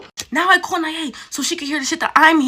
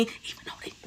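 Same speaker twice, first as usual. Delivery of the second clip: whispered trail-off; 24 dB quieter than usual. Retold or imitated. Quoting the coworker as he trails off, whispered. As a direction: fade to a whisper.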